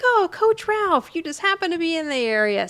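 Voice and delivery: high-pitched put-on surprise, easing down; hammed-up "what a coincidence" greeting; mock surprised greeting. Acting out Tony's greeting to coach.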